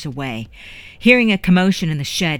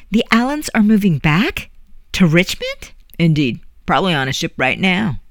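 ordinary delivery, nothing marked

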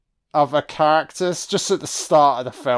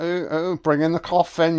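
normal talking voice

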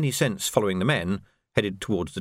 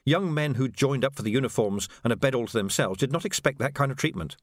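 usual speaking voice

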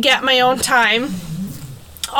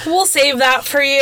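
ordinary talk, nothing marked

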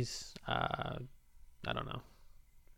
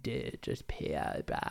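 lower, slow stoner frat bro drawl; frat boy voice; 10 semitones lower, 8 dB quieter than usual. Pretend. Host demonstrating his go-to frat-stoner voice, low and slow. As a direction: play a frat boy who smokes weed all day. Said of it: "my go-to voice just a fucking frat boy".

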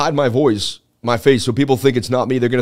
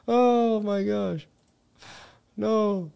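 laughing; 7 semitones higher, 8 dB quieter, much slower than usual. Real, not acted. Cracking up at his own impression.

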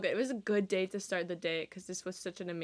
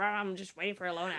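grumpy; faster than usual. Retold or imitated. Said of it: "I did not sound that grumpy".

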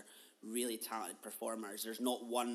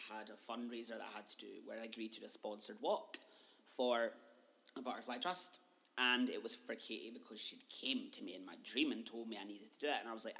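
usual speaking voice

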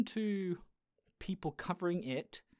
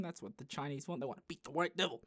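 ordinary talk

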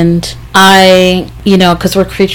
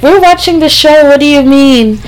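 high-pitched mock-innocent protest; retold indignant protest; 9 semitones higher than usual. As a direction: act out a kid protesting, pitched way up. Retold childhood protest to grandfather.

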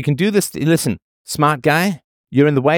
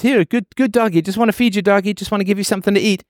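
much higher, sugary pet talk, faster at the end; coaxing a dog; 9 semitones higher, faster than usual. Retold or imitated. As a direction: lure the dog in with a high, honeyed voice. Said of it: "So the guy says".

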